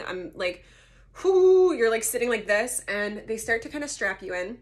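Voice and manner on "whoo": high, loud drawn-out whoo; nervous whoo. Retold or imitated. Patient realising surgery is really happening, high nervous whoo. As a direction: let out a big high "whoo".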